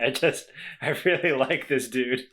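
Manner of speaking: usual speaking voice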